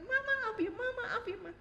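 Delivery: high, remorseful sorry; pleading apology. Retold or imitated. Back in mother's room, a high remorseful apology. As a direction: plead for forgiveness again. Retold apology after anger.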